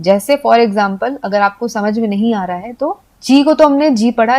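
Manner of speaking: usual speaking voice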